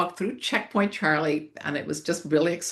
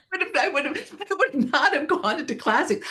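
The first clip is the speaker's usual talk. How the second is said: laughing; 9 semitones higher than usual. Real, not acted. Laughing at own past view.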